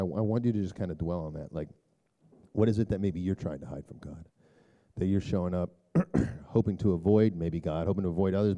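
ordinary delivery

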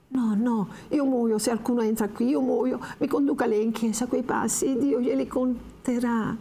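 high, pleading and desperate; a terrified girl begging, the last words slowed; frightened pleading. Acted. Lucia pleads in terror. (Lucia implora spaventata, voce acuta)

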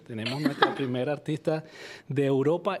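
ordinary talk

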